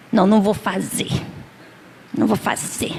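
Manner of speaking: acted refusal, digging in under the breath; stubborn refusal. Acts wife refusing husband's favour.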